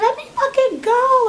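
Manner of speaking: very high mock shriek; squealing impression of a frantic scream; imitated screaming. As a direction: screech it like someone possessed, without full volume. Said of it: "And she's screaming".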